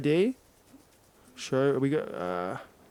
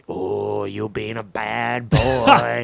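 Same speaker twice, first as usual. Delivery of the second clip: slow, drawn-out mock telling-off; loud hammed-up naughty-boy reproach; theatrical scolding; 2 semitones lower, 6 dB louder, much slower than usual. Acted prank voicemail scolding on stage.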